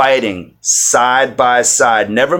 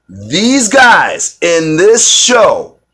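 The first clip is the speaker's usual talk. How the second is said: excited; 8 semitones higher, 6 dB louder than usual. Real, not acted. Raving about authentic accents.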